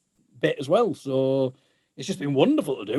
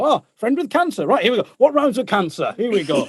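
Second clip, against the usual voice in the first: higher, bouncy pretend enthusiasm; mock-eager jingle patter; 7 semitones higher, 4 dB louder than usual. Singer pretending to launch a tasteless jingle, mock-eager and raised. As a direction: gear up gleefully, as a joke. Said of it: "'cause you can't just go".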